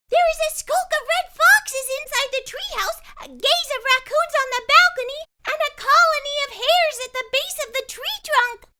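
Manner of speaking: put-on high, loud and quick girl's voice; eager young girl listing animals; excited. A narrator's reading. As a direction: rattle it off, thrilled. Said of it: "her eyes big with excitement".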